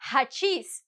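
hachís with the H rasped like a Spanish J. Shown as a demonstration. Teacher modelling a borrowed word for the class, H like J. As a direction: breathe the H of hachís like a J. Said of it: "as if in Spanish I would be using a J".

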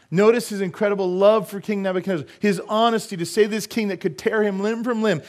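high, loud, emphatic preaching; forceful preaching. Acted. Preacher reading Daniel's plea to the king, forceful preaching.

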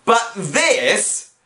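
high voice building to a drawn-out yell; exasperated rant. Acted. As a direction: argue until you're shouting in disbelief. Heated rebuttal in staged argument.

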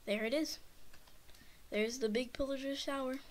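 ordinary talk, nothing marked

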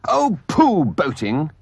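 high, loud scornful outburst; great disgust. A narrator's reading. Toad interrupting talk of boating, disgusted. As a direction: cut in with a dismissive pooh. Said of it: "interrupted the Toad in great disgust".

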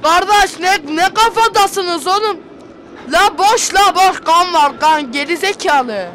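loud, slow frantic yelling; panicked shouting. Real, not acted. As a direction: yell it out in panic. Panicked gamer yelling at friends.

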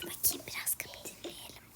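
fast, secretive whisper. Acted. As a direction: whisper as you listen at the door. Kapıyı gizlice dinliyor. (gizlice fısıldayarak)

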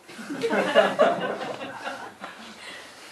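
long, high, airy laugh trailing off; laughter. Acted. Alone, admitting there is no dog to walk, high breathless laughter. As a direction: burst into high laughter that thins out.

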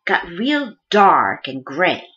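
ordinary talk, nothing marked

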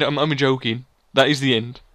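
ordinary talk, nothing marked

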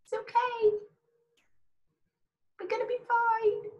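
a little voice inside saying it'll all be fine; high, gentle inner voice calming you; tiny reassuring inner voice. Retold or imitated. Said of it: "a tiny little voice in your head that just goes".

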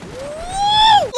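high, drawn-out whoop; triumphant whoop. Real, not acted. Gamer cheering after making it to the end, high whoop.

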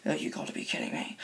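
fast, breathless whisper of disbelief; exasperated whisper. Acted. Friend sees yet another attack and whispers in despair. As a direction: mutter it under your breath in defeat.

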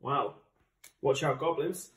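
ordinary delivery

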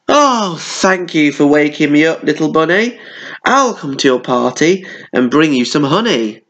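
high, delighted voice; delighted bear. A narrator's reading. Bear thanking the bunny for the party: high delighted voice. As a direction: gush with joy.

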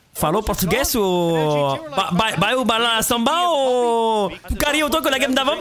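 mock Portuguese, vowels drawn out; playful stretched-out Portuguese-speaker impression; Portuguese accent. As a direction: put on a singsong Portuguese accent. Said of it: "je sais faire le accent portugais".

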